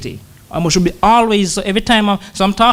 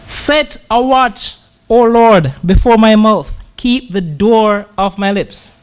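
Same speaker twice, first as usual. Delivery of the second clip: slow, lifted psalm prayer, louder on O Lord; slow earnest psalm recitation building on O Lord; prayer reading; 4 semitones higher, 3 dB louder, much slower than usual. Acted. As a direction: pray the verse slowly and earnestly.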